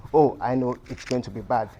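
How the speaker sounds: normal talking voice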